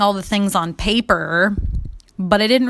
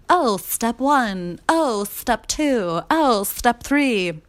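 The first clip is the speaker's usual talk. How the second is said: high, slow sing-song parody of a self-help guru; mocking sing-song; 5 semitones higher, much slower than usual. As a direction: drag out each step to mock it.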